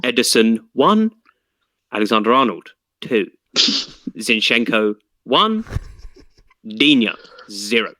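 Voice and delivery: lilting score reading, rising then falling; football results announcer. Pretend. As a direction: read fantasy points like the classified results.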